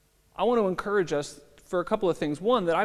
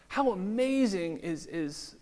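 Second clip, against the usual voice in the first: slowed right down, lifted in awe; slow marvelling; 4 semitones higher, much slower than usual. Real, not acted. Pastor marvels at Good Friday before church, slow and awed. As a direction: draw out How amazing is in wonder. Rhetorical wonder, said twice.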